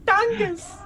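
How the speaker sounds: put-on high crying baby creature. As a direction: wail the name like an infant.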